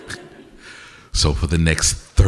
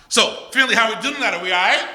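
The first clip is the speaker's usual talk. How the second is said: much higher, pumped call-out; crowd call. Real, not acted. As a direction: rev up the audience. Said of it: "crowd call, second try".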